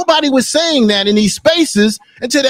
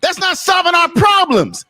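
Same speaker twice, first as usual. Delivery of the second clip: real heated shout, pitched up high; raised, furious rant at a panel guest; angry shouting; 6 semitones higher than usual. Real, not acted. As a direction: shout him down in real anger. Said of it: "you got this mad about".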